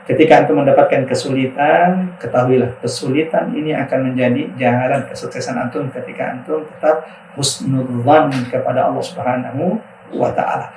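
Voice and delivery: loud, earnest preaching to students; preaching advice. Acted. Preacher advising students in pesantren, loud and earnest. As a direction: urge the young ones to keep faith.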